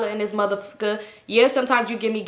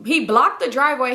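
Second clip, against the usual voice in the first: usual speaking voice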